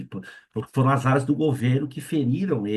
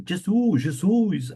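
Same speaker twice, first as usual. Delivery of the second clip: frightened cry; 6 semitones higher, much slower than usual. Retold or imitated. Terrified man calling on Jesus, panicked. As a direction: cry out the name, scared. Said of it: "ele falou em português".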